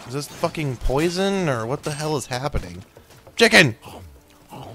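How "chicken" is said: loud, higher gleeful yell; excited shout. Real, not acted. Gamer spotting food, loud gleeful shout. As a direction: cheer at the find.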